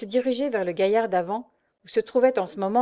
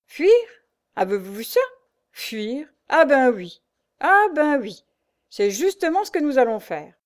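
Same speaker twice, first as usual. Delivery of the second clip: put-on gruff old sailor, higher and louder; old master grumbling; 6 semitones higher, 6 dB louder than usual. A narrator's reading. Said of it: "se contenta de grommeler". (vieux maître qui grommelle, plus fort)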